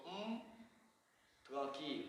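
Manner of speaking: "Un tranquilo" slurred to "tukakil", mouth barely open; closed-mouth colloquial. Shown as a demonstration. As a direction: say "tranquilo" lazily, lips almost shut. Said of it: "yo no abro mi boca". ("tranquilo" coloquial con la boca cerrada)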